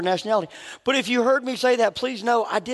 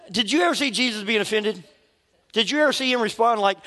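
ordinary talk

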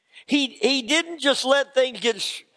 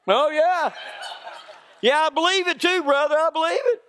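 sarcastic disbelief; 7 semitones higher than usual. Retold or imitated. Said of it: "sarcastic reply to the bragger".

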